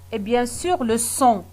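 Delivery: usual speaking voice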